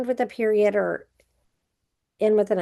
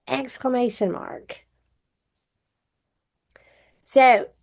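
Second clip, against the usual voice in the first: ordinary delivery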